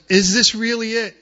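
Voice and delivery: high, loud desperate question; anguished cry. Retold or imitated. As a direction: cry it out in despair. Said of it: "And Elijah goes".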